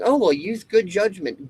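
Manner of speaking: hammed-up preachy proverb; mocking the saying. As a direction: chirp the cliché like a know-it-all. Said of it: "like the old saying".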